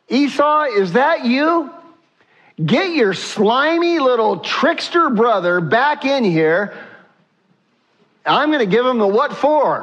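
high, hammed-up outraged father; mock indignant father. Imagined outburst of tricked father.

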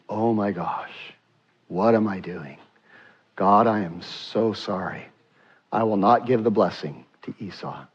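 low, hushed, humbled prayer; repentant prayer. Acted. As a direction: pray it softly and low, in remorse and gratitude. Repentant Isaac prays to God.

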